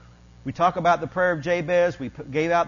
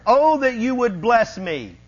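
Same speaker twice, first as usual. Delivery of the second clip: loud, slow plea to God; crying out; 4 semitones higher, 5 dB louder than usual. Acted. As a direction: cry it out high and slow. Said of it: "Jabez cried out to the God".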